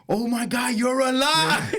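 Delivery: high, slow, loud cry; amazed relief. Retold or imitated. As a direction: greet someone back from the dead. Friends greet him at taco cart.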